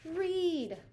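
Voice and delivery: loud, high shout of the answer all together; group shout. A narrator's reading. The kids shout the answer to Mister Lemoncello, loud and high. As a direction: shout it out, every voice at once. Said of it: "they all shouted at the same time".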